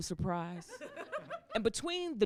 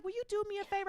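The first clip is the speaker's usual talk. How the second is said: mother's request; 11 semitones higher, 5 dB quieter, much faster than usual. Acted. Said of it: "She's like".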